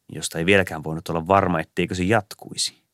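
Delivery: usual speaking voice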